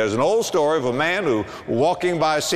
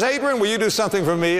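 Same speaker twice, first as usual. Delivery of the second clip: higher and quicker, a sweet-talking wife; wife's coaxing request; 7 semitones higher, faster than usual. Retold or imitated. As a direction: coax him lightly, pitched up and hurried. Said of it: "But suppose she says".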